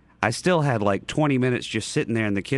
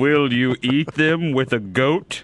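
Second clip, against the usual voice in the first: put-on reading to a toddler; slower than usual. Dr. Seuss read to kids.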